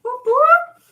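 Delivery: loud high whoops of joy; excited whooping. Real, not acted. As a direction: cheer it like a fan. Cheering a rocket landing live.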